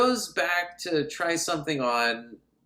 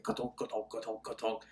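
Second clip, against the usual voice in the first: soft, low rhythmic hoofbeat noises; imitated galloping; 6 semitones lower, 15 dB quieter than usual. Imitating her stilettos that sound like galloping, soft rhythmic clopping. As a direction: thump along quietly like a trotting horse. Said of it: "it sounds like galloping".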